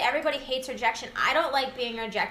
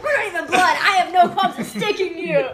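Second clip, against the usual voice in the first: laughing mock threat; 5 semitones higher, 7 dB louder than usual. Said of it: "this person's gonna be like".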